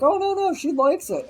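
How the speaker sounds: a hint of Tom's mock reassurance; dismissive Tom. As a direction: brush them off breezily. Tom defending the cartoon.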